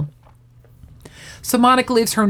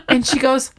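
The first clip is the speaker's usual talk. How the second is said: ordinary delivery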